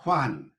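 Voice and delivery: "Juan" as breathed "hwan" with an open A; slow demonstration of "Juan", said HWAHN; Middle English pronunciation. Shown as a demonstration. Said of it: "Juan for when".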